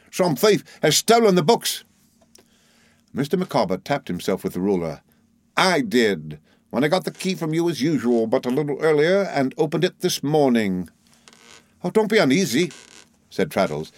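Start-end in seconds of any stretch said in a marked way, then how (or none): 0.1-1.8 much higher, agitated outburst; frightful cry. A narrator's reading. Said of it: "he cried with a frightful face". 5.6-10.9 lightly voiced proud confessor; higher-set, deliberate character voice; confessing clerk. A narrator's reading. 11.8-12.7 high, mild gentleman's voice; light, high, unruffled reply; reassuring lawyer. A narrator's reading.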